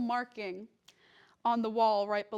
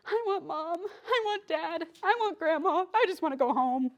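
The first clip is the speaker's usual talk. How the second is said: high-pitched imitation of homesick wailing; mock crying; 7 semitones higher, 4 dB louder than usual. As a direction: whine it like a homesick crier, played up. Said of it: "I was crying, being like".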